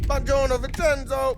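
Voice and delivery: calling out across the street; big cheery shout of hello; loud greeting. Acted. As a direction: hail him loudly in Italian. Calling out to Vincenzo on arrival.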